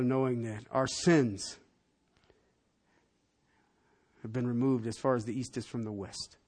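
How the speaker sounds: soft, low, hushed prayer voice; gentle pastor's prayer; closing prayer. Acted. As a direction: pray quietly over the congregation.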